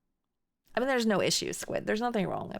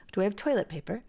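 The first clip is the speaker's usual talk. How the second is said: "toilet" carries the lift in the question; British inflection without the accent, "toilet" most prominent; British question intonation; faster than usual. Shown as a demonstration. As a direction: ask with the British tune, leaning on "toilet". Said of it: "But British is".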